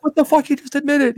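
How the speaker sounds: high, loud cry of disbelief; shocked at the unfairness, pitch way up; outraged disbelief. Retold or imitated. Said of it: "I'm like".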